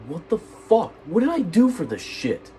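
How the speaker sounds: high, loud, outraged yell; outraged shout. Retold or imitated. Teen hearing friends spread rumors, outraged shout. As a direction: scream it in disbelief at the unfairness.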